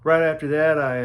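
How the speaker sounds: loud emphatic burst. Real, not acted. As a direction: punch it out to grab attention. Emphatic restart of story.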